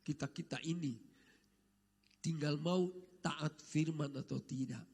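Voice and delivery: ordinary talk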